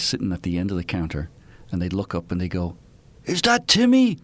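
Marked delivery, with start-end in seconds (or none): 3.2-4.2 high, loud, delighted hail across the bar; excited old-timers shouting a welcome; old pub regulars calling out. Retold or imitated. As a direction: call out like old barflies spotting a long-lost face. Old regulars greeting returning son.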